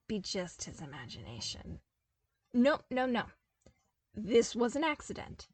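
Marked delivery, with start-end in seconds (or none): none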